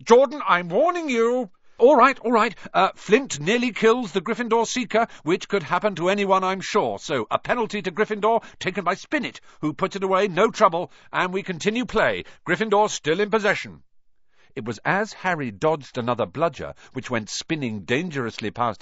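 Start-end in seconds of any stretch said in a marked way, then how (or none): 0.0-1.5 high, louder stern teacher voice; a strict professor reining in the commentator; stern warning. Acted. Professor scolding Jordan, a high stern warning. 1.8-13.8 high, lively match commentary; a biased student commentator calling the game; cheeky commentator. Acted. Lee commentating the match, high cheeky play-by-play.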